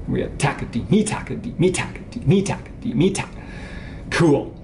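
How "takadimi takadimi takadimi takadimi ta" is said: "takadimi" with first and last syllables accented; stress on TA and MI. Shown as a demonstration. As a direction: chant TAkadiMI, high punches on "ta" and "mi". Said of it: "if we put the ta and the mi accented".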